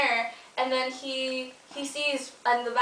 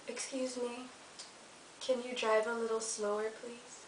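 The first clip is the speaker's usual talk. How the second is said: much softer, eerie polite request fading away; creepy ghost; 12 dB quieter than usual. Acted. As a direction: murmur it close behind his ear, politely chilling. Ghost woman speaks from behind.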